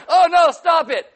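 high, slow, hammed-up giggly protest; coy flirting. Wife teasing husband, high coy protest. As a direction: play coy and flattered. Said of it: "Be like".

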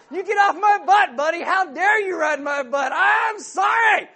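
loud, high shouted road-rage rant; mock road rage. Driver slamming brakes on a tailgater, loud high mock shout. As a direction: yell at the tailgater behind you. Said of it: "You slam on your brakes".

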